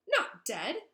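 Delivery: high, loud cry of disbelief; choked disbelief. Acted. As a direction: gasp it out in shock. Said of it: "choked Filch".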